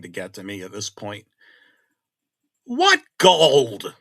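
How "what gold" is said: loud, high shout of alarm; near scream. Retold or imitated. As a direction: blurt it out at the top of your voice. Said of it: "practically screaming".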